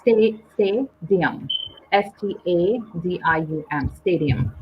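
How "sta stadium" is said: 'stadium' said slowly, broken off after 'Sta-' then said in full as STAY-dee-um; slow pronunciation model. Shown as a demonstration. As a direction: model the word 'stadium' slowly, first syllable alone.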